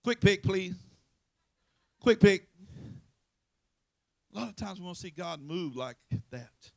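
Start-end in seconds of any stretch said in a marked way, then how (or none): 0.0-2.5 much louder hammed-up order; mock lottery buyer. Churchgoer at the Valero counter, loud mock order. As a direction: bark it at the store clerk.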